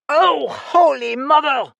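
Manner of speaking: high, loud, slow snarl; drawn-out furious cry. Acted. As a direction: spit it out in fury, loud. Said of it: "she snarled".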